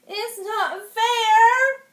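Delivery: high, slow, loud crying whine; wailing cry. Acted. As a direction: wail it like a child in a tantrum. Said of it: "cried the Grand Duke".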